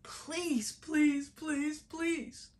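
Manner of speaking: high-pitched hammed-up begging; desperate pleading. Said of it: "pleaded and pleaded and pleaded".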